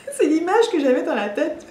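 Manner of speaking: laughs through the words, amused; real giggle breaking into the line; laughing. Real, not acted. Mother retelling the birth to viewers, laughing. Laughing at her own image.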